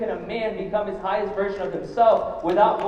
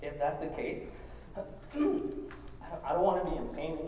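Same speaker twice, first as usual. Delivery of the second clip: holding back tears; 4 semitones lower than usual. Real, not acted. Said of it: "I'm gonna try not to cry again".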